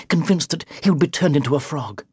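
usual speaking voice

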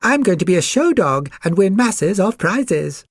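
high-pitched show-off puppy voice; vain poodle. A narrator's reading. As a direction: preen aloud in a high character voice. Vain poodle boasts of pampering.

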